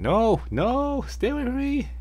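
very high frightened plea; high frightened cry; panicky plea. Retold or imitated. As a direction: recoil in fear. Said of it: "she's going".

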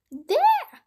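very high, loud shriek; screamed. A narrator's reading. Wife pointing out Jack to the giant, screaming. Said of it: "screamed his wife".

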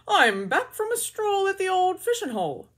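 high, loud cheerful badger. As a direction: boom it out like a jolly badger. Badger voiced.

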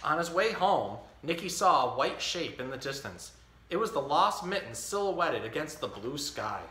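put-on reading a picture book to a baby; story reading. Dad reading The Mitten to his baby, storytelling. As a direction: read it like story time.

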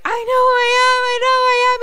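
very high, tearful comic wail; whimper-wail it in fake tears; mock crying. Mickey insists to Robbie D who he is, mock crying. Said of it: "And he's, like, crying".

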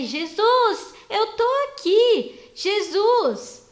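loud, high, insistent calling; shouting. Acted. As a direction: call out across a crowd to be heard. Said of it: "E ele continuou gritando o nome de Jesus".

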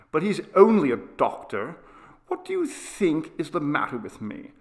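put-on high, exasperated child's protest; wronged boy, emphatic and pleading; indignant sick boy. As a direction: argue heatedly with your father, pitch swinging up. Boy's indignant protest to father.

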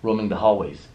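normal talking voice

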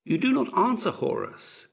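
high, loud opening, dropping on the name; surprised. A narrator's reading. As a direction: ask in puzzled surprise. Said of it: "asked Ramses' messenger in surprise".

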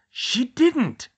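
high, loud burst of shock; shocked gasp. A narrator's reading. Hermione hearing Luna was cursed, shocked gasp. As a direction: exclaim it high, aghast. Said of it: "Hermione gasped".

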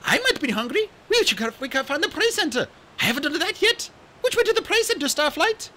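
put-on high, bright young dragonette voice; chirpy excited young dragon; eager little RainWing voice; Kinkajou's voice. Kinkajou keeps talking.

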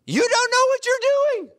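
high, loud preacher's holler; full-voiced, high-pitched outburst; emphatic yell. Real, not acted. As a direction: shout it at the crowd to drive it home. Hammering the yelled point at parents.

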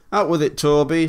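loud, high demand; impatient engine demanding the news; impatient interruption. A narrator's reading. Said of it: "Thomas interrupted".

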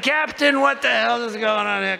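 hammed-up fright at the pilot; mock panic. President demanding answers from the captain, mock panic.

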